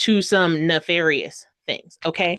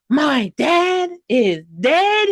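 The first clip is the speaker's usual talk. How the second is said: higher, louder, slow hammed-up disbelief; mock shock; 6 semitones higher, 5 dB louder, much slower than usual. Wyatt reacting to her dad's death, hammed-up shock. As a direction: shout it in fake shock. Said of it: "And she's like".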